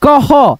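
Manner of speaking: "क ह" joined, with a breath of h before the final vowel; visarga as h. Shown as a demonstration. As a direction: model "क ह" slowly as ko-ho. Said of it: "उसमें मिला करके ह बोलेंगे". ("क ह" में विसर्ग का ह)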